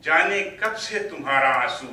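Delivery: declaimed Urdu verse, deliberate pauses between phrases; poetry recital. Acted. Poet reciting to an appreciative mushaira audience, poetry recital. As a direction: swell on repeated lines, ease down on the refrain.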